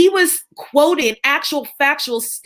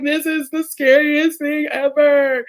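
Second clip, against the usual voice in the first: slow, higher mock-scared wail; retold terror; 4 semitones higher, slower than usual. On the school bus up the mountain, mock-scared and slow. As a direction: replay her panic on the bus, drawn out. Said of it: "I'm like".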